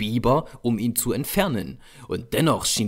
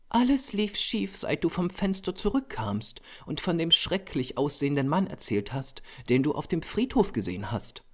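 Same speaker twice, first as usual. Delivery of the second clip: model's character voice; 7 semitones higher, 3 dB quieter than usual. A narrator's reading. Model telling the painter when everything went wrong, high then softening. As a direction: start high, then ease down into a softer account.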